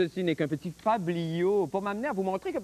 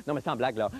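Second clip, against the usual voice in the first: ordinary talk, nothing marked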